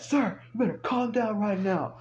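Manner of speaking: high-pitched retold command; urgent order. Cop to the raging truck owner, urgent order. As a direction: imitate a cop calling out over a yelling man.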